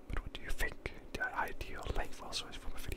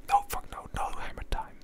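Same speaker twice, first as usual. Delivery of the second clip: emphatic whisper-shout; retold refusal; 10 semitones lower, 7 dB louder than usual. Refusing hammer time, a whispered mock yell. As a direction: stamp on each no.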